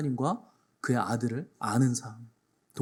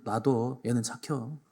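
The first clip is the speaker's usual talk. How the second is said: lightly voiced shrugging dialect, a bit softer; Chungcheong neighbour; 4 semitones lower, 6 dB quieter, slower than usual. Pastor voicing villagers shrugging off the youth, mild dialect. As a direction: brush it off like an old neighbour. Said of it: "사람들은".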